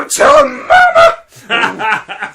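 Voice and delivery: high, drawn-out shriek; screaming student. Retold or imitated. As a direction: wail the threat slowly at full volume. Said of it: "she's screaming".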